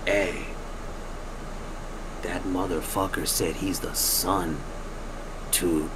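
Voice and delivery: low, soft retold warning; hushed confiding. Miguel pulls people aside to tell them, hushed. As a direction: drop it down as if tipping off friends.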